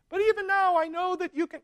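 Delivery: quoting Martha's hopeful line with a lilt; mock hopeful Martha. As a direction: chirp it up high and hopeful, half-mocking. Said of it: "there's none of this".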